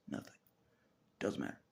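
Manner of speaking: usual speaking voice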